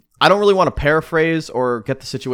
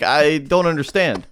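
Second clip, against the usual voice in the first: normal talking voice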